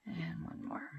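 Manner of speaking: soft whisper under her breath; hushed aside while crafting. Real, not acted. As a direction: whisper it to yourself while working. Quiet counting to herself.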